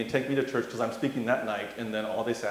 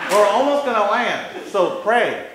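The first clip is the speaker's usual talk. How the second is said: a bit higher and louder woman asking; lightly voiced old lady; 8 semitones higher, 9 dB louder than usual. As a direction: ask like the pushy seatmate, lightly. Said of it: "I'm like".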